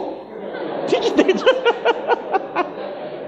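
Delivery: long airy laugh at his own joke; laughing breathlessly at the punchline; high breathy laugh. Real, not acted. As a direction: let out a drawn-out unvoiced chuckle. Preacher laughs at his own story.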